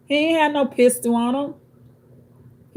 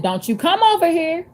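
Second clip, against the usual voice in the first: higher, commanding warning to back off; firm warning; 7 semitones higher than usual. Retold or imitated. Repeating the warning to the man across the street, firm. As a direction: hold him in place with the voice. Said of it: "I said".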